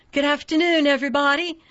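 put-on high, bright Southern-accented welcome; sugary Southern accent. A narrator's reading. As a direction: sweet-talk the crowd, Southern accent. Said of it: "in a sugary Southern accent".

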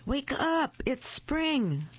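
high, excited storybook call; shouting. A narrator's reading. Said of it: "shouted Frog".

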